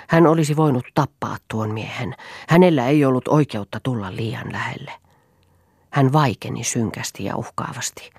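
plain reading voice

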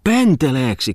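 high squealed question; shrill cry. Acted. Muttinen squeals at the silly name, high cry. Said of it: "kimahti aapeli Muttinen".

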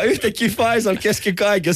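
normal talking voice

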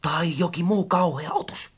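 low, soft, uneasy aside; hushed murmur. A narrator's reading. Friend fears some awful creature, hushed. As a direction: keep the dreadful guess hushed. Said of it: "Möttönen supatti vastaan".

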